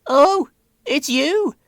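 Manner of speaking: high, slow, dry greeting; cheeky old lorry; Elizabeth, unimpressed. A narrator's reading. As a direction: tease an old acquaintance. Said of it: "said Elizabeth to the Fat Controller".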